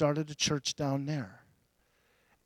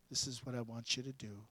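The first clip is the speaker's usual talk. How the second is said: hushed, gentle divine voice, lower; soft voice of God; 5 semitones lower, 15 dB quieter than usual. Retold or imitated. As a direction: speak as God, softly and low. God's words quoted.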